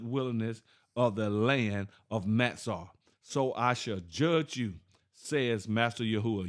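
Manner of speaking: slow, solemn reading of the verse; scripture reading. Acted. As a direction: lay each word down gravely, like scripture. Reads scripture aloud.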